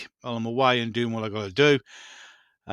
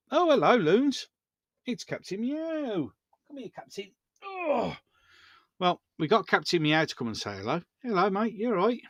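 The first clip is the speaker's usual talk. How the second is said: high, soft pet-talk voice; high sing-song talk to a cat; cooing to the cat; 7 semitones higher, 3 dB quieter than usual. Real, not acted. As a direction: coo sweetly to a pet cat. Greeting the cat at door.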